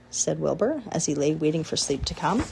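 ordinary delivery, nothing marked

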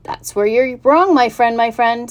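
a bossy goose chiming in; chatty goose. Acted. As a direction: goose correcting the pig. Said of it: "said a voice".

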